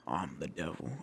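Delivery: low, soft, menacing devil. Retold or imitated. Devil in the dream whispering in his face, low and menacing. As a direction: say it low and quiet like evil itself. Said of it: "And he goes".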